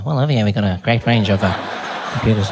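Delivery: low, confidential sales murmur; whispering back. Acted. Shop assistant copying the customer, low confidential voice. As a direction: slip into the customer's low hushed manner. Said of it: "people will whisper back to you".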